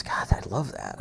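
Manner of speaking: soft, unvoiced half-whisper of delight; a hushed, amazed reply from the mover; hushed awe. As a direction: breathe it out like a revelation. Mover whispering in retold story.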